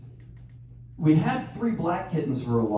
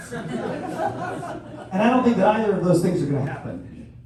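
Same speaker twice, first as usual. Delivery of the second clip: ordinary delivery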